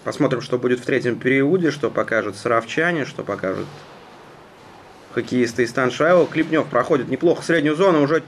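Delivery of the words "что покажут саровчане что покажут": ordinary delivery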